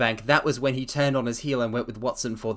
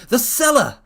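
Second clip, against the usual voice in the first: higher and louder sudden cry of discovery; excited realisation; 11 semitones higher, 8 dB louder than usual. Acted. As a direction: it just clicked, so blurt it out.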